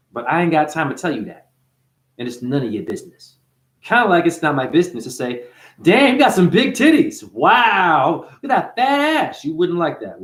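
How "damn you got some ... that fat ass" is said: loud, high-pitched exclaiming; mock crude ogling. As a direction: call it out like a tactless gawker, pitched up. Acts out rude remarks on someone's body.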